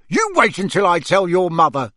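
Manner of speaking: father's angry warning; raging. A narrator's reading. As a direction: threaten the boys crossly. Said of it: "raged Mister Weasley".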